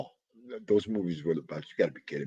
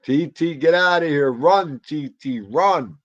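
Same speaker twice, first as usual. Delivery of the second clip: calling out; 5 semitones higher, 7 dB louder, slower than usual. Acted.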